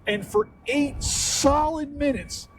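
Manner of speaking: normal talking voice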